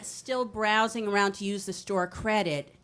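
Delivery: ordinary talk, nothing marked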